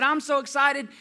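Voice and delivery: high, loud little-girl imitation; excited three-year-old. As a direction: gush like a thrilled small child. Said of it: "She's three".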